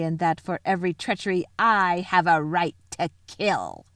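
imperious, shrill-voiced villainess; shrill witch. A narrator's reading. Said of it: "her voice growing suddenly shriller".

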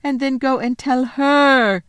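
high, remorseful faun voice; distraught confessing faun. Acted. Faun confessing his plot to a little girl, distraught. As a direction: confess it all in a high, guilty pour.

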